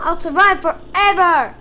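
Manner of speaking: loud, stretched mock-heroic cry; triumphant boast. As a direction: proclaim it, punching the last word. Boasting during play, later said plainly.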